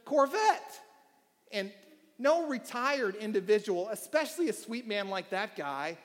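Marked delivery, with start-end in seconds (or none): none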